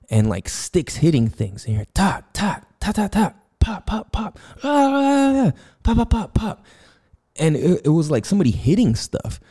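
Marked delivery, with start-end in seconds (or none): 1.9-6.6 high mock popping noises, tapped out fast; playful sound-effect imitation of distant banging; imitated banging. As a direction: mimic the sticks and pops outside, pitched up. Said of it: "we hear, like, yelling and banging".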